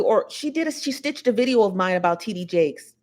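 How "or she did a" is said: ordinary delivery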